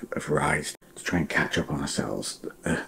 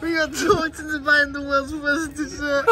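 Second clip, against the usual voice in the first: laughing; 4 dB louder than usual. Real, not acted. Laughing while driving, dessert fiasco.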